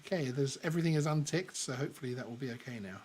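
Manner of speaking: mic turned way down, barely audible; very quiet. Real, not acted. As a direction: speak from far off the mic. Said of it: "Oh, you're really quiet now".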